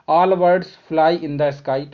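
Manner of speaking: very slow, each word spaced out; slow dictation. Shown as a demonstration. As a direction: spell out the statement for the class. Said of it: "इसका answer हो जाएगा". (बहुत धीमे, हर शब्द अलग)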